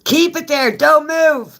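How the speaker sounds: high, loud shout; loud high-pitched urgent call; calling out orders. Real, not acted. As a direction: holler the command, pitch way up.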